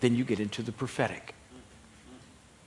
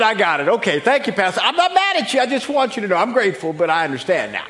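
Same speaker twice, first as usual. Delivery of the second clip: high, loud comic voice breaking into laughs; imitated let-down congregant; 11 semitones higher, 10 dB louder than usual. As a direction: ham up polite thanks for a small gift. Acts out congregant's comic letdown.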